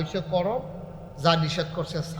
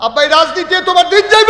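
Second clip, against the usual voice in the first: shouted reproach; 13 semitones higher, 15 dB louder than usual. Acted. Preacher scolding those who skip prayer for politics, shouted reproach. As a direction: shout it out, climbing to the last word.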